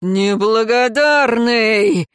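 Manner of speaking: slow, drawn-out angry roll; stretched, booming rebuke; rumbling reproach. A narrator's reading. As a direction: thunder it slowly as an accusing father. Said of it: "зарокотал отец семейства".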